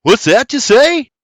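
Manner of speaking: loud, high half-deaf shout. A narrator's reading. William deaf from the shot, shouting loud. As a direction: yell it as if your ear still rings.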